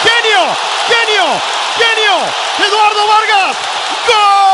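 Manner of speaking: roaring goal celebration; goal shout. Real, not acted. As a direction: belt out the goal call.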